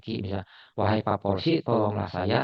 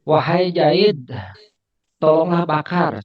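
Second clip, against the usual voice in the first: louder, slow call to Jaid; 4 semitones higher, 5 dB louder than usual. Shown as a demonstration. Teacher acts out calling Jaid for help in class.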